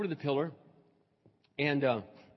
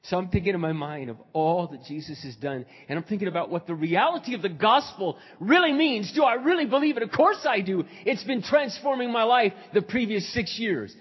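loud, high-pitched sermon delivery; impassioned preaching; 6 semitones higher, 9 dB louder than usual. Real, not acted. Pastor testifying to the congregation about the gospel, impassioned. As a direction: preach it with rising fervour.

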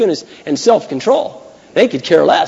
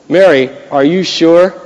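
slow, louder teasing question to Mary; mock coworker; 6 dB louder, slower than usual. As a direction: lean in and ask her, slow and pointed. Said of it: "And they go".

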